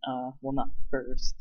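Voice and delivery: usual speaking voice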